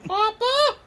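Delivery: very high, loud squeal; hammed-up high-pitched wail; mock cry for papa. Acted child answering the whispered taunt.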